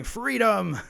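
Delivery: high, loud war cry of freedom; rally the troops with one word; William Wallace battle cry. Retold or imitated. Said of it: "they're pulling a William Wallace".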